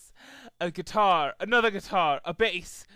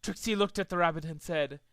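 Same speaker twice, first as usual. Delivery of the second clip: ordinary delivery, nothing marked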